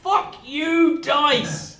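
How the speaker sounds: loud, high-pitched outburst at the dice; angry curse. Real, not acted. Player cursing the dice after a bad roll, angry shout.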